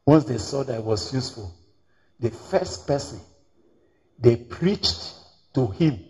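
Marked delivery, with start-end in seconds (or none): none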